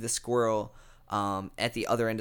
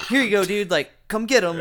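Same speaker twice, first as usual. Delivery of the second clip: higher, louder coaxing call; calling a dog; 9 semitones higher, 7 dB louder than usual. Retold or imitated. Man tossing cornflakes to a loose squirrel, calling it like a dog. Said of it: "Like he's a fucking dog".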